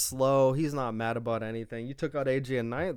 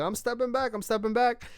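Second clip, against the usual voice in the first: higher, nervous mock retreat; backing off; 8 semitones higher than usual. Jimmy backing off from L A Knight, a high nervous retreat. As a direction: play Jimmy backing away with raised hands. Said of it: "And then Jimmy's like".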